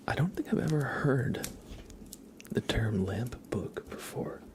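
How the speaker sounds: soft unvoiced whisper greeting chat. Real, not acted. Streamer greeting viewers in chat, whispering. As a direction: breathe the words gently.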